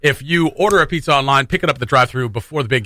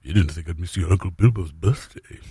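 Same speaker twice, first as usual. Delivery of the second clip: lower, softer, deep lightly voiced Gandalf; Ian McKellen as Gandalf; 8 semitones lower, 6 dB quieter than usual. Shown as a demonstration. Host attempting a soft Gandalf line, lightly voiced like Ian McKellen. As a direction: attempt a gravelly old wizard. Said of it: "a little bit of an Ian McKellen".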